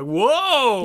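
exaggerated double take, laughing; mock amazement. Joking about glancing over at urinals, mock amazement. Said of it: "if you look over and you're like".

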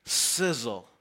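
low, hissing sizzle; like a steak on the grill; sizzling sound word. Shown as a demonstration. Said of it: "Here's my favorite".

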